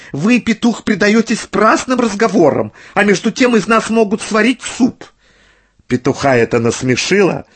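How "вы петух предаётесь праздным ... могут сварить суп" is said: high-pitched fretting hens; high, agitated poultry voice; alarmed hens. A narrator's reading. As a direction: scold the rooster in a high hen voice. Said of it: "заговорили куры".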